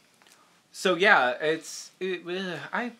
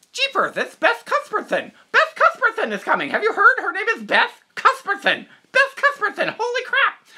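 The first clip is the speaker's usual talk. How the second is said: high, loud, giddy gushing with laughter; excited gushing; 9 dB louder than usual. Retold or imitated. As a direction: gush about a funny name. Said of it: "And we were like".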